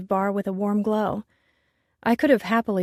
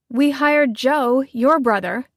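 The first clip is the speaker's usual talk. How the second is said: loud, slow, higher angry retort; on the warpath; 7 semitones higher, 7 dB louder, slower than usual. Acted. Chef on the warpath at her partner, loud and slow. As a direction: fight back hard, spelling it out. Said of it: "with Nell on the warpath".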